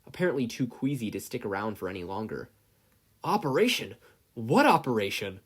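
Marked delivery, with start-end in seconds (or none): 3.2-5.4 loud, rising alarm; startled and scared. A narrator's reading. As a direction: bound man alarmed by the word operation. Yagi alarmed question.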